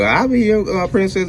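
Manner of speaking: lightly voiced high movie line; a hint of a movie princess; movie quote voice. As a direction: quote the movie in a mild put-on voice. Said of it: "in Coming to America".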